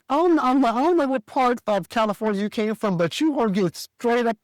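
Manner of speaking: hammed-up telling-off of a freeloader; retold furious shout. Ex gets told off in his house, mock furious shout.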